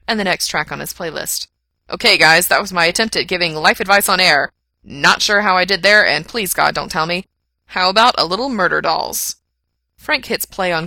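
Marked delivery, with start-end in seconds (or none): 1.9-9.4 loud on-air DJ patter, a little sheepish; radio host. A narrator's reading. Host regaining professional persona on air, loud and breezy. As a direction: project to your listeners, brushing off the awkwardness.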